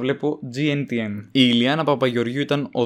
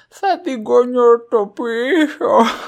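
mock Tsakonian accent, much higher, louder and very slow; Tsakonian style; 12 semitones higher, 7 dB louder, much slower than usual. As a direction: holler it in a hammed-up Tsakonian accent. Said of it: "τσάκωνα style". (με υπερβολική τσακώνικη προφορά, αργά και δυνατά)

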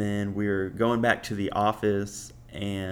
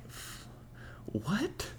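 breathless, disbelieving whisper; stunned whisper; 8 semitones higher, 11 dB quieter than usual. Real, not acted. Telling the listener about his date's microdosing, in a stunned whisper. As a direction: barely voice the shock. Date describes microdosing acid.

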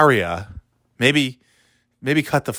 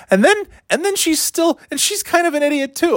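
much higher, sing-song mockery. Mocking the wife in a high sing-song voice. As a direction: pitch up high to mock her.

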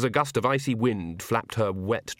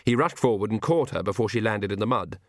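plain reading voice

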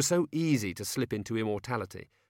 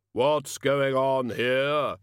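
drawn-out, booming challenge, pitched up; loud demand; 5 semitones higher, 7 dB louder, much slower than usual. A narrator's reading. Intruder confronting the room, loud demand. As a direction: call it out slowly and forcefully.

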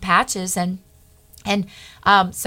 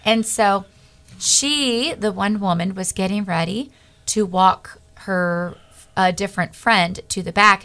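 ordinary delivery

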